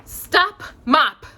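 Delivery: loud, high call to the mop; called command. Acted. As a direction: shout the spell to halt it. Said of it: "Merryweather called out".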